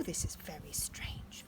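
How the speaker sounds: hushed whisper of puzzlement; Mummy Bear whispering. Acted. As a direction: breathe it secretly.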